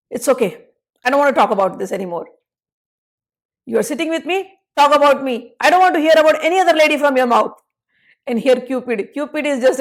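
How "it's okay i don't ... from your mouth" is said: sulky anger. Acted. Lalita still angry.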